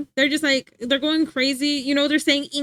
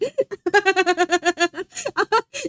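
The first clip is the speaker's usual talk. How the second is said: long high giggling laugh; cracking up; real laughing fit; 4 semitones higher than usual. Real, not acted. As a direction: laugh helplessly at a joke. Finds the message so funny.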